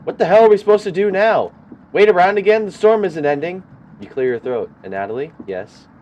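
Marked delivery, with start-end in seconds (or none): 0.0-3.6 much higher, much louder angry shout; furious yelling. Acted. Karen yells at the group about waiting out the storm, furious. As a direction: scream your frustration at everyone.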